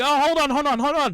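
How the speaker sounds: high, loud frantic pleading; frantic hold on. Retold or imitated. Brothers trying to stop Mrs Claus, frantic and loud. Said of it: "they're like".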